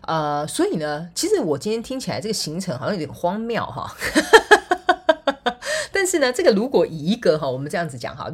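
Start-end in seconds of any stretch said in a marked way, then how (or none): 4.0-5.8 high laugh. Real, not acted. Laughs at absurd schedule.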